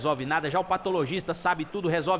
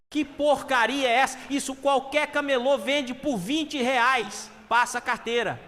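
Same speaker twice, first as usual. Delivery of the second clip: high, raised-voice indignant complaint; dissatisfied robber; 7 semitones higher than usual. Acted. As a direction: scoff at shoddy loot, pitched well up. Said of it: "com cara de insatisfação".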